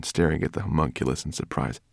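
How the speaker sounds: ordinary delivery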